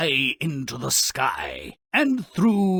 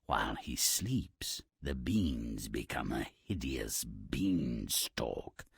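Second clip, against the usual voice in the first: slow, low ominous whisper; 13 semitones lower, 9 dB quieter than usual. A narrator's reading. Narrator telling of the beanstalk growing, slow ominous whisper. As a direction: hush it like a dark secret.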